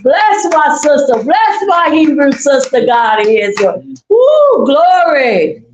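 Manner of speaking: high, loud church praise, building to a cry of woo; joyful praise shout. Real, not acted. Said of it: "You're gonna make me shout up in here".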